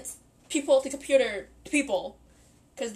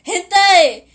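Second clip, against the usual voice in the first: loud shouted accusation; loud mock anime outburst; 10 dB louder, slower than usual. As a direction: yell it like Haruhi at Tamiki. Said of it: "how Haruhi says to Tamiki".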